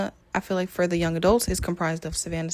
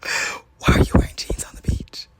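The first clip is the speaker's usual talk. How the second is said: gasp, then a forceful stage whisper; mock-shocked whisper; 5 dB louder than usual. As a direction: whisper it as if scandalized. Gasps, repeats question in mock shock.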